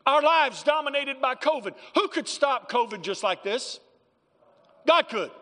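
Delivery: loud, high, driving pulpit preaching; fiery sermon delivery; preaching at full force. Acted. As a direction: preach it hard to the congregation.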